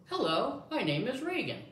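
high-pitched lady voice; lady's voice. Shown as a demonstration. Showing the listener the lady voice, high and lilting. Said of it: "the lady's gonna say".

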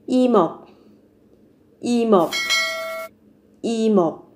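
"Y mộc" linked, the m carried onto the second syllable; linked sounds. Shown as a demonstration. Teacher models "Y mộc" for learners, sounds linked across syllables. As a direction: run "Y mộc" together as i-meop, no break. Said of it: "nối âm lên nhé".

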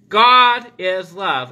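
God is love, with God slow and loud; slow, weighty God with a falling close; stress on God. Shown as a demonstration. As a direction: lean hard on God, then let love fall. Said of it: "to be read with the emphasis on the word God".

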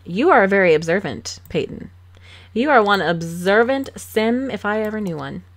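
mock praise with punched emphasis; dry, teasing sarcasm; sarcastic. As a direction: congratulate the obvious, sarcastically. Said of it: "I'm sorry, my sarcasm is".

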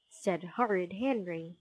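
ordinary delivery

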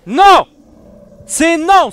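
loud high yells of no; panicked screams. Real, not acted. Player yelling at the attacking monster, panicked screams. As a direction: scream to stop it.

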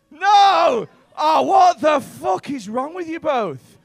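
high, slow exasperated yell; mock outraged shout. Comic berating couple for not knowing the song, outraged shout. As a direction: bellow in comic disbelief, stretching the words.

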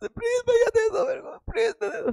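fake-cry like a child begging; mock crying plea. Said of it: "जोर जोर से रोने लग गई". (नकली रोते हुए बहुत ऊँची आवाज़ में गिड़गिड़ाना)

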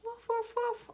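high-pitched little woofs; quick, high barking imitation; little dog bark. A narrator's reading. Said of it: "Another little dog".

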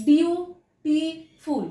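বিউটিফুল slowly in three spaced syllables; broken into syllables. Shown as a demonstration. Teacher repeating the syllable count, বিউটিফুল slowly broken in three. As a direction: pause after each part of বিউটিফুল: বিউ, টি, ফুল. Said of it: "তিনটে syllable".

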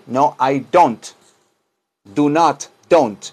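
slow, careful no I don't; slow model answer. Shown as a demonstration. Teacher slowly modelling the reply for students. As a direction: spell out the answer slowly for the class. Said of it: "Me preguntan, "Do you need?".

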